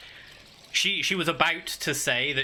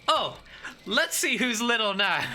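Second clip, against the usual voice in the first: playful taunt; 8 semitones higher than usual. Pretend. Joke voicing of offended small character.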